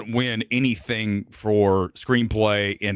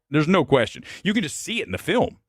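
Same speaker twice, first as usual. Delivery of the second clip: ordinary talk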